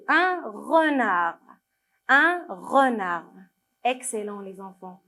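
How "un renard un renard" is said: "un renard" very slow, the re held out; slow model reading. Shown as a demonstration. Teacher giving children the completed word, "un renard" slow and drawn out. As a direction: stretch each syllable of "un renard". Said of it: "c'est re".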